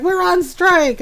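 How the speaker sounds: high, loud playful declaration; mock defiant. Unfed animals refusing to work, loud high mock defiance. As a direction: announce it in hammy protest. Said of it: "Nope, we quit".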